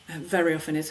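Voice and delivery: normal talking voice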